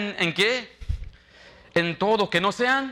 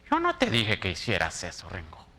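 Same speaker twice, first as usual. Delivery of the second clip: mock accusing boss; 8 semitones lower, 4 dB quieter than usual. Pastor imitates his supervisor denying her orders, mock accusing. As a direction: play the boss denying she said it.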